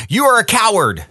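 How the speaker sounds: high-pitched storyteller's mock scream; mild angry shout. A narrator's reading. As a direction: call it out high with a touch of anger. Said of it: "This made me angry, so I screamed".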